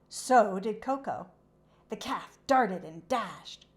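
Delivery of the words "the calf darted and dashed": plain reading voice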